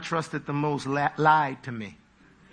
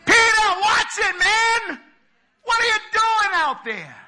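high, loud teasing shouts with a laugh; mocking disciples; 6 dB louder than usual. Disciples in the boat jeering at Peter, high loud laughing shouts. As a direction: heckle him from the boat. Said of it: "looking at it with natural terms".

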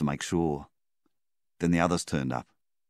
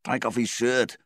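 higher, urgent command; order; 8 semitones higher, 3 dB louder than usual. A narrator's reading. Donnie ordering Flynn during the rescue, urgent higher command. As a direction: bark the order to get it done. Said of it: "Donnie ordered".

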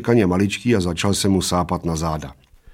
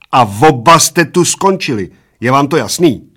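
stern ultimatum; 5 semitones higher, 6 dB louder than usual. A narrator's reading. Waitress threatens to throw them out.

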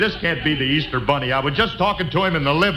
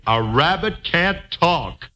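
slow drill; 2 semitones higher, slower than usual. Acted. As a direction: spell it out slowly for someone to repeat. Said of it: "just repeat after me".

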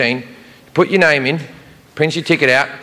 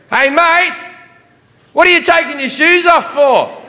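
high, hammed-up Australian airport guard; Australian security guy, pitched way up; Australian accent; 11 semitones higher than usual. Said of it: "the T S A guy goes".

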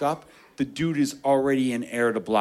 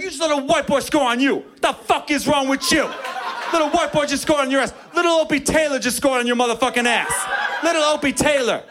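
mocking opponents; 10 semitones higher than usual. Other team mocking their guy, high laughing taunt. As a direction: jeer at the defender, laughing.

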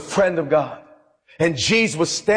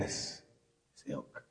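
disgusted whisper; 23 dB quieter, slower than usual. Acted. Disgusted at Satan's followers.

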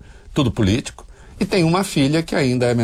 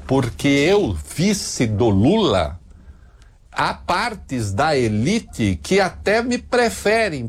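slow, measured politician's statement; mock vice-president; 4 semitones higher, slower than usual. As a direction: spell it out slowly like a careful statesman. Voices Lula's vice in the first person.

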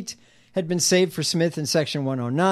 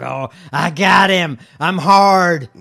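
smug smirking senator; 8 dB louder, slower than usual. Retold or imitated. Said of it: "And he just smirks. He's like".

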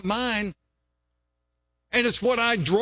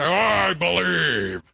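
mock declaration; much slower than usual. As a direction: stretch it out like a proud boast. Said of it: "and say".

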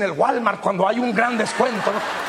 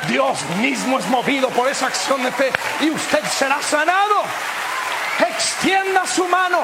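preaching at full force; 2 semitones higher than usual. Acted. Preacher to his congregation, shouting the call to faith. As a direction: build it up until the church rings.

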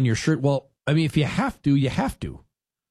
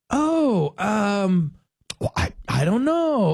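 high, hesitant mock dithering; clueless coworkers; 5 semitones higher, much slower than usual. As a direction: play the coworkers caught unprepared. Imitating clueless coworkers at bar.

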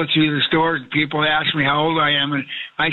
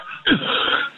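sudden throaty cough into the phone; real cough. Real, not acted. Caller coughs mid-answer about sounding old, a dry real cough. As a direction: cereal stuck in his throat, a real hacking cough. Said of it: "I thought you were acting".

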